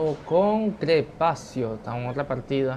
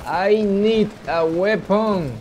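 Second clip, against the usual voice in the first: mock action-hero line; 7 semitones higher, 5 dB louder, much slower than usual. Switches to English mid-game.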